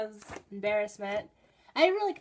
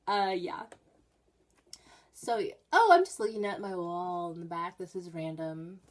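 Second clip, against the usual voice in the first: normal talking voice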